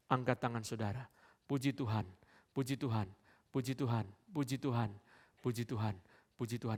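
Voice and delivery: ordinary talk, nothing marked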